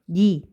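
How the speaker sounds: 무니 in separated syllables, plain ni; 무니 ending on a simple i. Shown as a demonstration. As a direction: say 무니 as mu-ni, no eu glide. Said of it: "요렇게 읽으면 된답니다".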